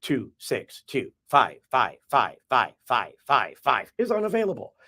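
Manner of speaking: slow robotic recorded greeting, digit by digit; automated voicemail. Acted. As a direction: read each digit separately like a phone system. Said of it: "the automated voicemail comes on and says".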